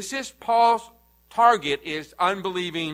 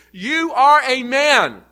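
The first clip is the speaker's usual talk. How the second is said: slow, higher and louder, stress on ARE; emphatic rebuke; 7 semitones higher, 7 dB louder, slower than usual. Real, not acted. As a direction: hammer home that he is only a man. Preacher puts man in his place.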